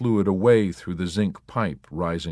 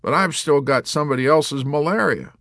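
high, loud aggrieved plea; warrant officer; 9 semitones higher, 5 dB louder than usual. A narrator's reading. Warrant officer complaining to Yossarian, aggrieved. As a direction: wish for justice.

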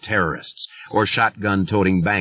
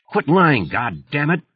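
shouted; 7 semitones higher than usual. A narrator's reading. Writer yelling at the killer on the phone, furious. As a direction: yell it at him, raw fury. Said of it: "Thad shouted".

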